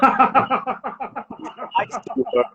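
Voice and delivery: high, very slow drawled threat; mock-grand accent on the threat; put-on posh accent. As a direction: stretch it out in his own posh accent. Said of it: "she uses my accent".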